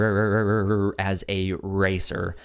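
usual speaking voice

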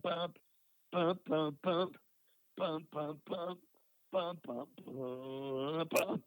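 punchy bump bump matching the punches; vocal chord hits. Retold or imitated. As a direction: hit each bump like a chord stab. Imitating chords hitting with punches.

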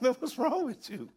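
high-pitched, amused question; laughing. Retold or imitated. Sergeant laughing at his shaking troop, laughing question. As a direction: barely get it out for laughing. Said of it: "I'm laughing".

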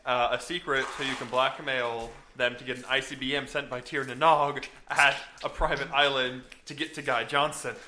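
ordinary delivery

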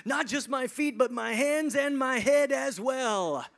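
high, loud and slowing plea; eager Peter. Retold or imitated. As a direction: overdo it, all in. Extremist Peter begs more.

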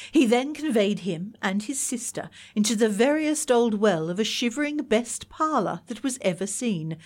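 usual speaking voice

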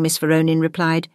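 plain reading voice

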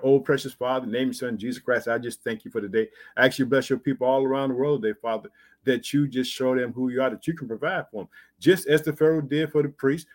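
low-pitched, earnest prayer voice; closing prayer. Real, not acted. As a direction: pray it low, running on from phrase to phrase.

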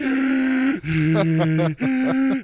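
loud exhausted gasp for breath; gasping for air. Retold or imitated. Telling friends about the hike, a winded gasp. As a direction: gasp like you can't get air. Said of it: "I'm like".

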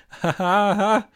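a very high, loud, hollow laugh; forced laugh. Acted. Mother covers her despair with a loud hollow laugh.

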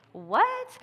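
high, playful What; a bright, put-on gasp of disbelief; mock surprise. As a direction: pretend to be amazed. Mock disbelief at same-day delivery.

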